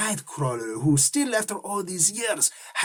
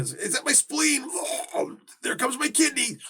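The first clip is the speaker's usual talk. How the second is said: high, strained mock panic while retching; mock puking panic; 10 semitones higher, 4 dB quieter than usual. Imagining himself puking in a fight, high mock panic. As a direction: squeak it out as your insides come up.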